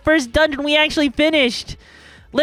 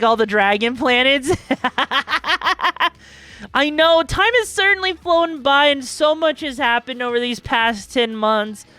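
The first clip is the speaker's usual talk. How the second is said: ordinary talk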